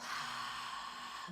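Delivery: long breathy sigh out through the mouth; slow demonstrative out-breath; audible exhale. Shown as a demonstration. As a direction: let the whole breath go in one long sigh.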